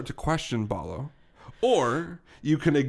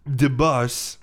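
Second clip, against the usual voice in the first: put-on slow, heavy Russian-accented henchman; 7 dB louder, much slower than usual. Henchman ordering the detective to wait, Russian accent. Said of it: "he's Russian, by the way".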